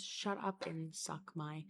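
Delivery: soft, slow recited phrase; mock Italian phrase. Reciting her one memorised Italian phrase, softly. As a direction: drop it quietly like a secret. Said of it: "I think it was".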